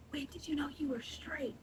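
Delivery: hushed whisper, flipping the question; mock whisper. Shown as a demonstration. Turning the coworker's question around in a whisper. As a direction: whisper it back to mock him. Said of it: "Why did you have to whisper that?".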